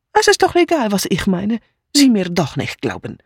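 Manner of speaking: put-on broken German with a strong foreign accent; scornful outburst from an agitated foreign maid; indignant foreign maid. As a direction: play an aggrieved refugee with accented German. Mitzi retorts to the inspector.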